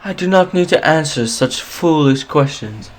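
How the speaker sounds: high, lofty dismissive noble voice; haughty noble. Acted. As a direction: sniff at the question like a snob. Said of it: "crosses his arms and looks up at the air like".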